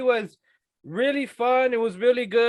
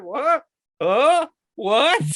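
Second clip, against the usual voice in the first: confused; 5 semitones higher, 6 dB louder, much slower than usual. Retold or imitated. Confused victim retold.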